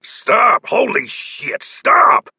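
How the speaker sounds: high, loud barked command; barked order. A narrator's reading. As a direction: bark them to a halt. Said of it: "barked Vincent".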